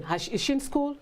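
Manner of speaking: lightly voiced eager helper; quick question.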